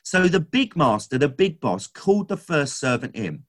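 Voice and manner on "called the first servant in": ordinary talk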